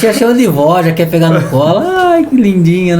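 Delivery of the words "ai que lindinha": cooing grandma. Retold or imitated. Customer voiced cooing at baby.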